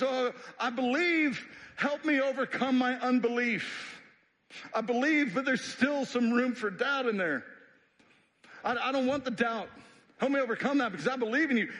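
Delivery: high, loud desperate plea from a father; pleading father. Acted. Father of a sick son begging Jesus, high and loud. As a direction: cry out begging for help. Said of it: "the man exclaims".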